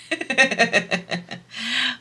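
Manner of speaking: high-pitched amused giggle; a run of high giggles; laughing. Real, not acted. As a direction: laugh at the absurdity. Amused by the odd law.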